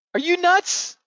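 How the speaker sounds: high, loud disbelief; incredulous. Real, not acted. To viewers who believe China, a shrill incredulous cry.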